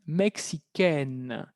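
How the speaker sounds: "Mexicaine" slowly, ending "ken" with a clear N. Shown as a demonstration. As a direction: draw out "Mexicaine", open E then N. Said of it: "la A I se pronuncia E".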